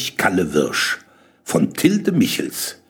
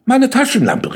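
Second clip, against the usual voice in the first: put-on high boyish exclamation; pitched-up, eager call; 11 semitones higher, 4 dB louder than usual. A narrator's reading. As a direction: exclaim at a sudden idea. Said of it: "rief Max".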